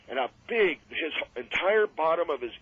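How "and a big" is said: usual speaking voice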